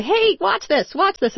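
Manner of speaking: put-on high-pitched kid showing off; playful mock-kid attention call; imitating excited kids. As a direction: call out like a child wanting an audience. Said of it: "the way that kids jump around and say".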